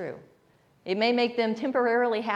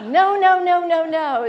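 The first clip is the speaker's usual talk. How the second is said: higher, louder, laughing protests; alarmed no; 7 semitones higher, 10 dB louder, slower than usual. Retold or imitated. Owner stopping the dog from eating soap, alarmed. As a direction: cry no no in mock alarm.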